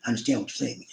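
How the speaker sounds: soft, attentive understanding; a boss showing he really listens; empathetic boss. Retold or imitated. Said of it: "he leans into you".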